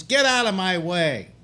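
imitated confrontational yell; retold shout. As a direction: shout it like someone in your face. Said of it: "and shouts in my face".

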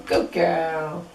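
ordinary delivery

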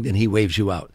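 ordinary talk

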